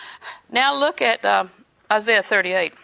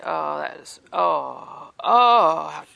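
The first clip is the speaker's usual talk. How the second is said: hammed-up sympathetic sigh; mock pitying friend; 6 semitones lower, much slower than usual. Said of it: "we want five friends to come along and say".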